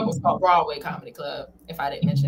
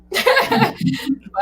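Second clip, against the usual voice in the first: a sudden high burst of laughter; 14 semitones higher, 4 dB louder than usual. Real, not acted. Tired mom laughing at her own joke, high laugh. Laughs about bags under eyes.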